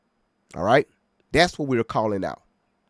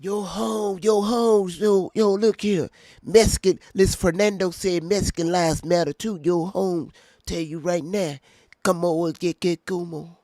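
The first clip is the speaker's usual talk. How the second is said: higher-pitched put-on voice; hammed-up voice, pitched up from your own; mock commenter voice; 4 semitones higher than usual. Voicing a commenter's Mexican-lives remark.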